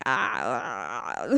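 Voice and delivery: low, soft, drawn-out mock groan; stumped groan. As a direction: drag out a low, reluctant "ah". Acting the man's stumped hesitation.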